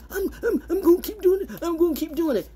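high laughing mock whine of an addict. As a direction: sneer it in a squeaky mock whine. Mocking a drug addict.

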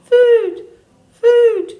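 put-on loud, very high baby-bird cry; peeping gosling. A narrator's reading. Hungry gosling interrupting the adults, loud high peep. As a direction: pipe up loud and tiny. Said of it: "peeped the gosling".

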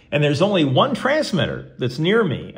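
ordinary talk, nothing marked

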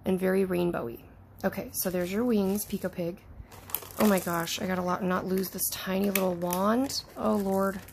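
ordinary talk, nothing marked

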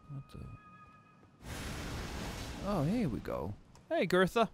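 ordinary delivery

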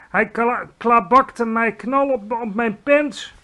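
high, loud, slow outraged shout. A narrator's reading. Giant kicked awake in the belly, high loud shout. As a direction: shout it, stretching the words. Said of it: "schreeuwde de schrokschranser".

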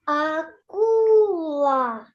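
Акула called out slowly, stretching the second syllable ку; Акула drawn out like a call, stress on ку; call the word out. Shown as a demonstration. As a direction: shout Акула slowly as if calling someone far away. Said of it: "Погукай слово акула".

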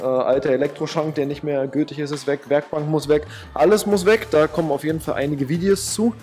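normal talking voice

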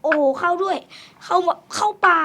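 loud, high excited yell; excited shout. Real, not acted. Gamer cheering as his arrows hit, shouting. Hitting shots in game.

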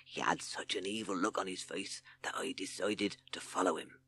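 secretive whisper. Acted. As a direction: whisper it like a conspirator. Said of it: "lowering his voice".

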